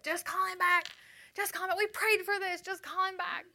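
high, loud urging; urging friend. Retold or imitated. Friend pushing her to call back, high insistent urging. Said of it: "And she's like".

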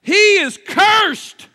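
high, loud, slow accusation; mock accusing crowd. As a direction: islanders crying out in horror. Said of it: "they said about him".

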